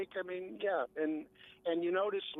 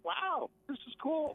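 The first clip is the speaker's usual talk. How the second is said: high, drawn-out amazed wow; 12 semitones higher, slower than usual. Retold or imitated. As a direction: gush about it like a thrilled rider. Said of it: "it's like".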